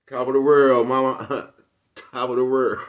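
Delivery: loud, high, half-laughing movie line; lightly quoting the laughing character; Juice movie quote. Retold or imitated. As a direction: toss out the line from Juice with a grin. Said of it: "my favorite part in Juice".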